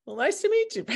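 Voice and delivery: high, laughing greeting; a real laugh through the words. Real, not acted. Laughing at the coincidence.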